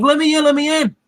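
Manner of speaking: high, frantic plea to be let in; desperate begging. Retold or imitated. Kid trapped outside begging the group, in a high panicked plea. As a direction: beg the door open, panicking.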